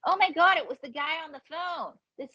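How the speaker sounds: high-pitched mock astonishment; sudden realisation. Comedian realising who the phone guy was, astonished. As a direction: act out the penny dropping.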